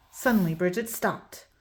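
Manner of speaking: plain reading voice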